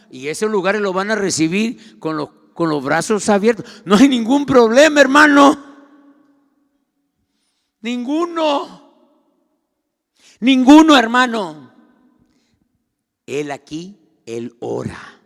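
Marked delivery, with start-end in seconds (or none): 3.8-11.7 high, loud ironic assurance; sarcastic shout. Acted. Preacher mocks churches welcoming anyone, sarcastic loud shout. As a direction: shout it as mock reassurance. Mock acceptance of corrupt preachers.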